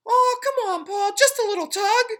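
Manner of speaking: tiny, high, loud tempting voice; pigtail voice. A narrator's reading. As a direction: lure him like a little devil. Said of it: "the pigtail said to him".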